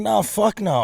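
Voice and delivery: high, emphatic mock protest; animated retort. Retold refusal to wife.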